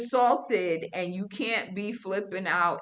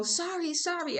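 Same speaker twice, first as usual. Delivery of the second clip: apologetic bystanders; 11 semitones higher than usual. Retold or imitated. Passengers in the taxi apologizing to her, higher mock voice. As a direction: imitate passengers sorry on her behalf. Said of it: "Everybody was saying sorry for her".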